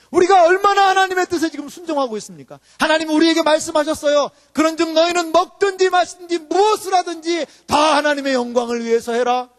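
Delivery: high, forceful proclamation; full-force preaching. Real, not acted. Preacher quoting God's commands to congregation, full-force proclamation. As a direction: declare scripture to the whole hall.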